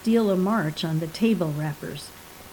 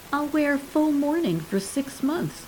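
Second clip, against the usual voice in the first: lightly voiced wife prattling on, a bit higher; 6 semitones higher than usual. A narrator's reading. Wife prattling about mourning to her dying husband, breezy chatter. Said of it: "prattled Abby".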